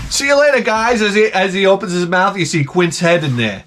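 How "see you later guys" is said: repeating the Jaws voice. Acted. Repeats Jaws's goodbye after laugh.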